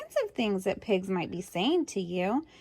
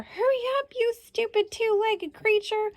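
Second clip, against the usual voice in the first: high, impatient mock snap; a bad-tempered pig hurrying the farmer; grumpy pig; 12 semitones higher than usual. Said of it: "you might hear".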